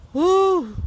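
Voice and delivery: a sharp, high, loud whew of disbelief; loud incredulous whew; high exasperated whew. Real, not acted. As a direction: blow out a shocked, high whew. Exasperated reaction to the quote.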